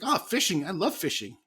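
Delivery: put-on high, delighted child; imitating son's high excited voice; high kid voice. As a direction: gush like a little boy about fishing. Child voiced in retold story.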